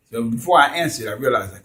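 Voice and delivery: ordinary talk, nothing marked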